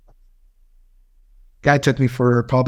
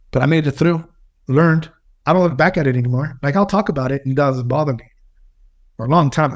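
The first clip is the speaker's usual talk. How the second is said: normal talking voice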